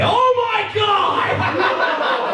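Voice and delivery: high shocked yelp into laughter. Real, not acted. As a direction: gasp then laugh in disbelief. Shocked at the scene.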